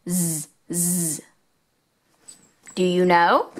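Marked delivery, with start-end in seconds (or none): 0.1-1.2 soft buzzing "Z, z" as a long zzz; z sound. Shown as a demonstration. Teacher to children, a soft buzzing "z" sound. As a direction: buzz "z" quietly like a bee. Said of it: "starts with Z".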